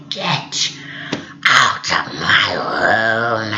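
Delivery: very low, dragged-out monster growl; groggy beast. Retold or imitated. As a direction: let the sleeping beast rumble it out slowly. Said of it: "this beast gets unleashed".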